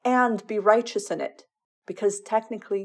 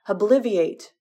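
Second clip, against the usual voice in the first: a sharp spoken spell, a touch louder; casting a spell; 3 semitones higher, 5 dB louder than usual. A narrator's reading. Draco casting a spell at the muggle, a sharp call. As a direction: fling the spell out.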